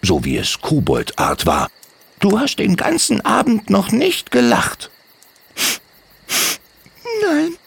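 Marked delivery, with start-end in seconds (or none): 2.2-4.9 kobold Timmy. Acted. Timmy talks to Sventlani. 7.2-7.6 put-on a sad girl puffing out a no; huffed little no. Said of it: "schnaufte sie leise".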